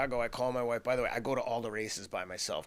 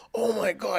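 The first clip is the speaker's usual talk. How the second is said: much higher and louder, overwhelmed; the wife's voice catching with emotion; emotional gasp; 10 semitones higher, 8 dB louder than usual. Retold or imitated. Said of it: "and starts to get emotional".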